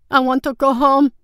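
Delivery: put-on high, loud childlike wail; bawling. A narrator's reading. Brother on the dock wanting home, bawling loudly. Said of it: "Su Shong bawled".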